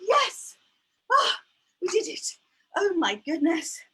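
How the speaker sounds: excited yes. Real, not acted. Teacher cheering after getting the count right.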